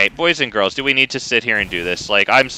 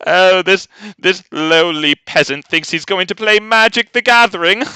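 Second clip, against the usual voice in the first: louder hammed-up British lord, sneering; lordly British accent; 8 semitones higher, 5 dB louder than usual. Streamer's impression sneering at a peasant opponent, lordly British accent. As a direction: look down on the lowly peasant. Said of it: "that's my impression".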